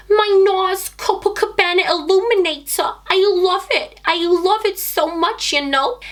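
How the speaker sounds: high, hammed-up New York accent; New York accent attempt. Shown as a demonstration. Vlogger shows her makeup in an attempted New York accent. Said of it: "an attempt at my New York".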